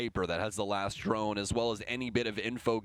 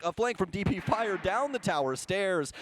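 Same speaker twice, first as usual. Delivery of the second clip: excited play-by-play; 8 semitones higher than usual. Real, not acted. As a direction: call the clutch like the crowd's on its feet. Clutch moment in live casting.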